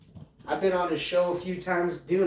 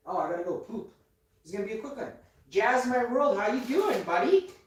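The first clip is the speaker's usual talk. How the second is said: ordinary talk, nothing marked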